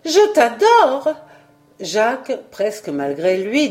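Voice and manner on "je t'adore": a slow, high, loud outcry of love; passionate cry. A narrator's reading. Simone crying out her love to Jacques. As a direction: throw the words at him from the heart. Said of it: "Elle n'eut qu'un cri".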